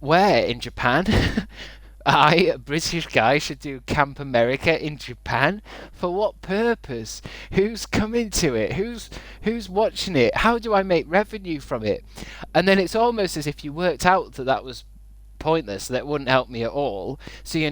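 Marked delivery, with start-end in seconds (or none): 5.9-12.0 high, slow, disbelieving questions; incredulous mockery. Real, not acted. Streamer mocking chat's advice with high, slow disbelief. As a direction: pick the idea apart, each question pitched higher and drawn out.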